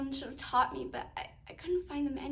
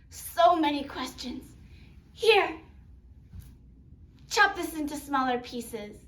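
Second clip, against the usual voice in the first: brusque, louder grandmother; grandmother Popo; 6 dB louder than usual. Acted. Popo sets her questioning grandchild to chopping, brusque and loud. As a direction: brush off the questions, then order briskly.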